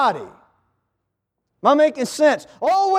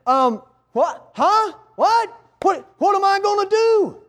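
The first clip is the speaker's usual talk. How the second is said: higher, rising what, what, caught off guard; startled donkey; 6 semitones higher than usual. Acted. Donkey just told it's retired, higher startled what. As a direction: jolt up in pitch as the news lands.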